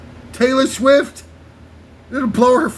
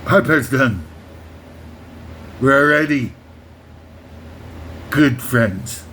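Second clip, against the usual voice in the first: much lower, mumbled Hoffman voice; Dustin Hoffman impression; 8 semitones lower than usual. Hoffman speaking to Quint, low mumbled impression. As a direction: drop low like Hoffman at the end of Tootsie. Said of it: "Dustin Hoffman from the end of Tootsie".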